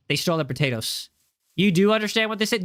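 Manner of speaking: usual speaking voice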